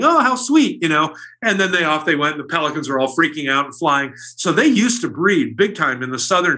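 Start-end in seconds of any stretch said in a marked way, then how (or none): 0.0-0.8 high cooing "oh how sweet"; mock sweet adult coo; doting adult. Adults cooing over kids.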